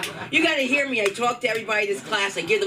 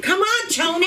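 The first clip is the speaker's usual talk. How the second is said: calling out Tony; 10 semitones higher than usual. Pretend. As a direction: yell the name like a Sopranos wife. Voicing a Sopranos-style yell.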